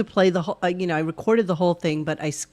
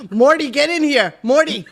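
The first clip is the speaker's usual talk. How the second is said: calling out; 6 semitones higher, 7 dB louder than usual. Acted. Calling out to Morty.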